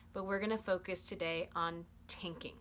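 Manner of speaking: ordinary talk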